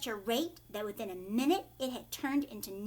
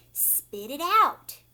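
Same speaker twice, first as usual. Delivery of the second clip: loud, slow command; stern warning; 4 semitones higher, 10 dB louder, much slower than usual. Retold or imitated. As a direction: order her to spit it out. Wonka warns Violet about gum.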